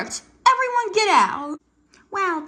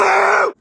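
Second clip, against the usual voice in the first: startled scream; 10 dB louder than usual. Acted. Startled by the cat's boo.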